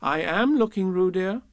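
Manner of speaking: a lightly voiced stern mother; severe Kanga. A narrator's reading. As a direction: chide the child firmly. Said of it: "said Kanga rather severely".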